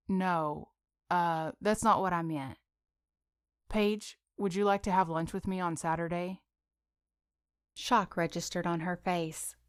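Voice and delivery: plain reading voice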